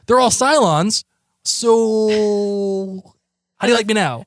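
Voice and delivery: taunting. Retold or imitated. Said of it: "as if it makes you say".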